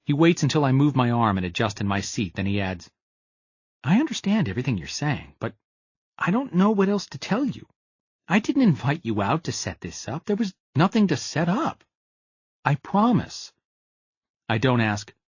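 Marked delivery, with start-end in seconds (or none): 3.8-13.5 higher, sincere reassuring friend; earnest Gus. A narrator's reading. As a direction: promise him it's true.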